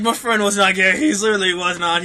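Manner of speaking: normal talking voice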